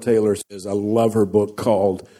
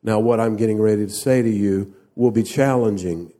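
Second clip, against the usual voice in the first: ordinary talk, nothing marked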